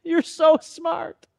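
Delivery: high, slow, doting praise. Retold or imitated. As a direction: coo over the clever child. Praising the child.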